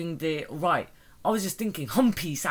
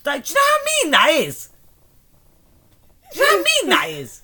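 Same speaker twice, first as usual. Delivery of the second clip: high, loud protest at being teased; indignant protest; 10 semitones higher, 8 dB louder than usual. Real, not acted. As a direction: squeal it in mock outrage at a friend. Protesting friend's teasing, indignant.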